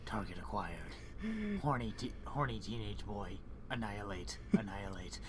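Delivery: low whispered killer robot; menacing machine, hushed and low. Acted. Terminator robot voicing Miku.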